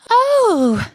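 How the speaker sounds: very high, small creature voice; little Tim's high voice. Acted. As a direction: squeak it like a tiny frightened animal. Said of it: "said Tim".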